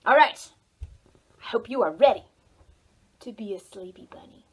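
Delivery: usual speaking voice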